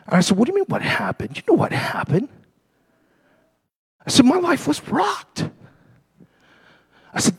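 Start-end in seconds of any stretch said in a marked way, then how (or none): 0.4-2.3 high, hushed protest to God; indignant retort. Retold or imitated. Man answering the Holy Spirit's question, indignant in a soft high voice. 4.2-5.5 high, soft, slow protest; pained complaint. Retold or imitated. Man telling God what happened to him, pained and high.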